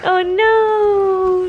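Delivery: high, loud mock alarm; talking to a dog. Real, not acted. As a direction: pretend worry for the pup.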